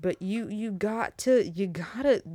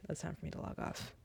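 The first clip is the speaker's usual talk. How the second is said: whispered, sheepish aside to herself; whispered self-conscious sign-off; embarrassed whisper; 2 semitones lower, 15 dB quieter than usual. Real, not acted. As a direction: breathe it out, half to yourself.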